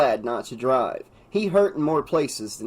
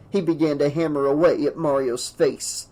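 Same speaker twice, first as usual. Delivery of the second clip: plain reading voice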